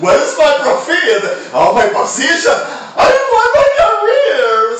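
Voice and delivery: high, loud mocking shriek; imitated scream. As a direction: scream it like a greedy rival, drawn out at the end. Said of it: "he was screaming this dedication".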